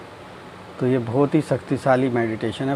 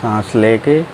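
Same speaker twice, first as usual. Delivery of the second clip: low, slow drawn-out meditation voice; guided meditation; 5 semitones lower, slower than usual. Acted. Teacher guiding friends through breathing and relaxing, guided meditation. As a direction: stretch key words slowly, sinking lower at each phrase end.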